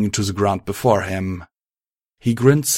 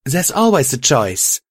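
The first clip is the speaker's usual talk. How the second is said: higher, forceful rebuttal; livid insistence; 5 semitones higher, 4 dB louder than usual. A narrator's reading. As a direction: push back with raised pitch. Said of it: "She was positively livid now".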